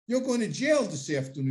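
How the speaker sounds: a friend in another voice warning him off; imitating John. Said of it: "He goes".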